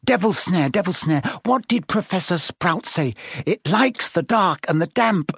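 high, pressured muttering to herself; frantic recall. A narrator's reading. Hermione trying to remember her lesson, frantic.

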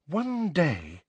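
high, exasperated sigh of words. A narrator's reading. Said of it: "sounding thoroughly exasperated".

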